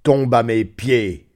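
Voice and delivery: lent, plus fort, menaçant; voix terrible. Acted. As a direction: ordonne comme un maître redoutable. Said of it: "me cria-t-il d'une voix terrible". (ordre crié d'une voix terrible)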